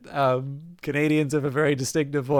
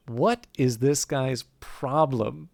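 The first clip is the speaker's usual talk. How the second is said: slow, incredulous; much slower than usual. Acted. Phoenix inner thought.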